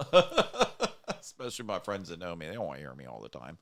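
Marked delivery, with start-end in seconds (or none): 0.1-0.9 high, loud "ugh" of mock disgust; mock groan of dismay. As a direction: groan as if dreading that voice. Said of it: "probably go".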